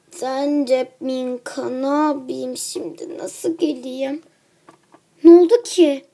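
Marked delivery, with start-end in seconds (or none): 0.1-4.2 slow, soft tired voice; weary and ill. Acted. Sick Pepper telling her friend she couldn't come, slow and tired. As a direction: drag it out like you're too ill. Sick girl answers wearily.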